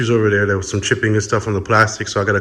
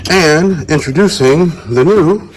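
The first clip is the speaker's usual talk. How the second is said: announcer reveal; 6 semitones higher, 6 dB louder, slower than usual. Real, not acted. Dramatic product reveal.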